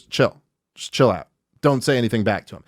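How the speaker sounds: put-on low, understated voice of a kid settling a friend; calm aside. Kid to his friend as tension rises, low calming aside.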